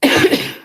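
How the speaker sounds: breathy cough clearing the throat; real cough on food; choking cough. Real, not acted. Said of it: "I think I'm choking on some granola".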